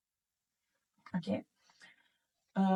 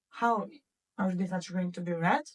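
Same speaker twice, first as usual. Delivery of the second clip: ordinary talk, nothing marked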